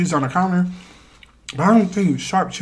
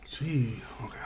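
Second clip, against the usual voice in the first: whisper; 4 semitones lower, 12 dB quieter than usual. Real, not acted. Whispered aside.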